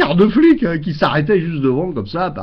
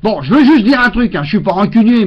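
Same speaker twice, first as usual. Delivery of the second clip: ordinary talk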